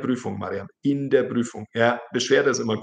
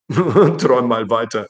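laughing it off, a bit louder; amused, disbelieving laugh; laughing scoff; 7 dB louder than usual. Real, not acted. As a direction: scoff through a real laugh. Mocking an absurd chat claim.

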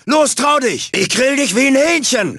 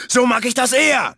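normal talking voice

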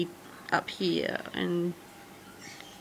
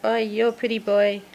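ordinary talk, nothing marked

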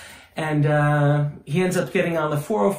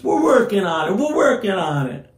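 club owner catchphrase; 6 semitones higher, 4 dB louder than usual. Retold or imitated. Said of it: "his thing that he always used to say was".

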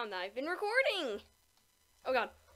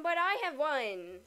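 ordinary delivery

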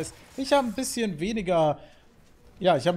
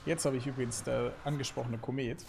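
ordinary talk

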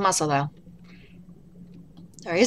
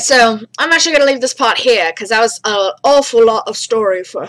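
ordinary delivery

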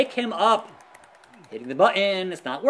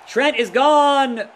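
dismayed shout; 6 semitones higher, 5 dB louder, slower than usual. Real, not acted. Losing a teammate in the match.

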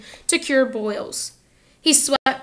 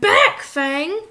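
Hagrid calling off dog; 5 semitones higher, 4 dB louder than usual. A narrator's reading. Hagrid shouts at his dog.

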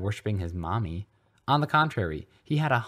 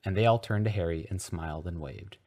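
usual speaking voice

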